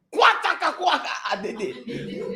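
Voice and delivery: gibberish syllables dissolving into laughter; laughing mock Chinese. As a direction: keep imitating the man while cracking up. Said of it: "that's how the man is talking".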